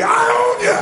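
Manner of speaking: very high, loud challenge; intense. Shown as a demonstration. Coach pushes the crowd for intensity, high fierce shout. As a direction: throw it out at full intensity. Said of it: "say it with more intensity".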